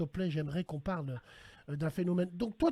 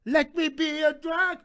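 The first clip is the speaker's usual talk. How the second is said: mock drag performer; 5 semitones higher, slower than usual. Imagines deepfake of himself at pride.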